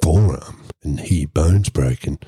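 usual speaking voice

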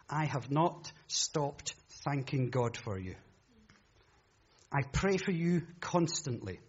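slow, measured Bible reading; reading the passage to the congregation; scripture reading. Acted. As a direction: read it out with reverence.

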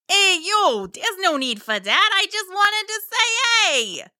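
high, chummy sea-monster voice; high cartoon monster being sociable; friendly mosasaur. A narrator's reading. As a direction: greet them like an overly friendly, laid-back creature. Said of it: "announced in friendly tones".

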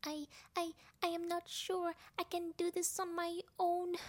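high-pitched, hesitant little voice; timid storybook creature losing nerve; Piglet, nervous muttering. A narrator's reading. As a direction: stammer it high and unsure. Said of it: "Piglet muttered".

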